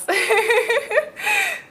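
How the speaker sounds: high-pitched laugh; real amused laughter, high in pitch. Real, not acted. Amused while handing over to guest.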